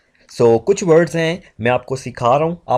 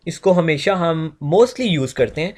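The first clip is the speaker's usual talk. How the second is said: ordinary talk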